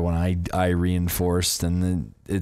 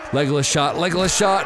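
excited repeated cheer ending in a high shout; 8 semitones higher than usual. Real, not acted. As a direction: yell it out like a fan. Cheering his archer's shot.

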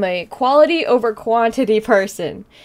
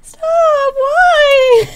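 very high, loud tearful squeal; real crying; 14 semitones higher, 6 dB louder than usual. Real, not acted. Streamer overcome by viewers' support, crying. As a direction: plead through sobbing laughter. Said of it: "while I cry".

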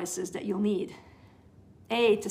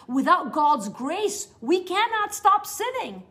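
emphatic; 6 semitones higher, 6 dB louder, slower than usual. Real, not acted. Preacher stresses key point.

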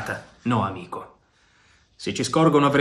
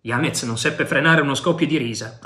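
plain reading voice

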